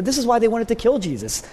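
ordinary talk, nothing marked

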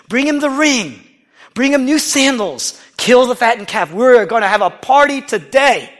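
high, loud festive orders; joyful father. Acted. Father calls servants to the feast, high and loud. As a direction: announce the feast with joy.

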